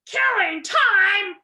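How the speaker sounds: furious roar. A narrator's reading. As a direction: roar it at him, outraged. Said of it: "roared the dog so furiously".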